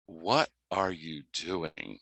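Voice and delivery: slow, low, quiet wondering; puzzled thought. Retold or imitated. Assistant watching a little boy approach, slow and hushed. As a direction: think it aloud, drawn right out and soft.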